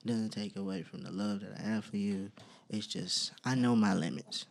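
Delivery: low, soft and sincere; gentle reassurance. Real, not acted. Man telling the ladies he knows his limits, gently reassuring. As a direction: let them down gently, voice dropping low.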